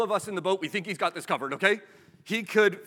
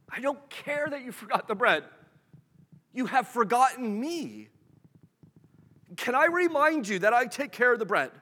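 higher, pleading voice of Jesus; Jesus rebuking; 6 semitones higher than usual. Retold or imitated. Jesus reminding the disciples he provides, higher pitched. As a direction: lean in and remind them gently but firmly.

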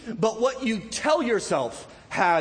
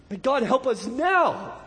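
ordinary talk, nothing marked

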